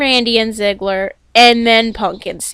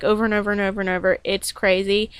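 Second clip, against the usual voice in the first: normal talking voice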